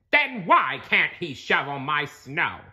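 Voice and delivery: high, sharp demand; demanding Squidward. A narrator's reading. Squidward demanding answers from SpongeBob. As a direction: press the question like a grump. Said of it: "Squidward demanded".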